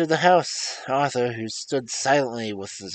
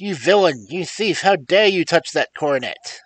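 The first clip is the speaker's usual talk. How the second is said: higher, louder angry accusation; father yells at his son caught with the coronet; furious outcry; 5 semitones higher, 6 dB louder than usual. A narrator's reading. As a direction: scold the thief in shock and fury. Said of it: "I screamed".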